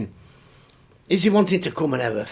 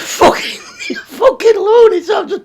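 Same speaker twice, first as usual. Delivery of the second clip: much higher, loud laughing cry; laughing outburst; 13 semitones higher, 4 dB louder than usual. Real, not acted. Laughing at a stranger's weird email. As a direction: burst out laughing at the madness.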